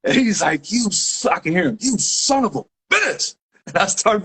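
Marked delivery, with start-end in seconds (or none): 0.7-1.3 mock outrage. Retold cop curses the runner. 1.8-3.4 high, drawn-out retold curse; mock outrage. Retold cop curses the runner.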